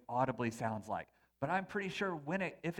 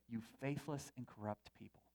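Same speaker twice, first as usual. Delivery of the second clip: much softer and lower, "faithless" pressed gently; hushed rebuke; 4 semitones lower, 9 dB quieter than usual. Retold or imitated. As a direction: murmur "faithless" as a stunned quote. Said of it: "voice say".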